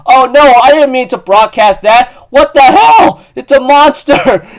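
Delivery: high, loud flustered panic; panicked. Retold or imitated. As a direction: squeal it out in a panic. Bao panics after broadcasting.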